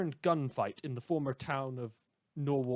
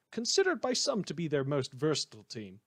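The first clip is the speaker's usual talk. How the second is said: ordinary delivery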